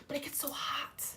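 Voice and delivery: soft whispered aside. Real, not acted. Streamer whispering a complaint about heat. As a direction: breathe it out like a secret.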